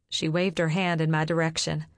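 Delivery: usual speaking voice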